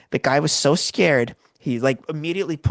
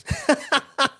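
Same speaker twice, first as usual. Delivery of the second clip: laugh; 13 semitones higher than usual. Real, not acted. Laughing at Chris's message.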